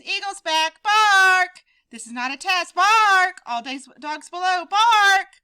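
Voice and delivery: loud high barked warnings; alarm barks. Acted. Big Bark warning the dogs, loud barking alarm. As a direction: sound the alarm like a watchdog.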